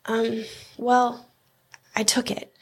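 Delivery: hesitant, halting confession; slightly subdued, awkward admission; embarrassed. A narrator's reading. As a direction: own up sheepishly, with pauses. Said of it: "Joey looked embarrassed".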